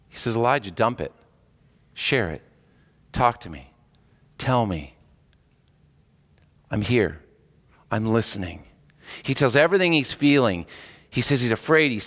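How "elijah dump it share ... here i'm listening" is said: gentle God. Pretend. God gently inviting Elijah to share.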